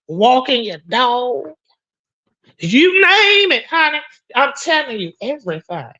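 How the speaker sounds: normal talking voice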